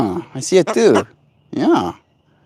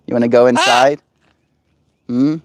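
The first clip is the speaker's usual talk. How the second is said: ordinary talk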